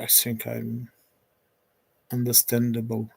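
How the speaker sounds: ordinary delivery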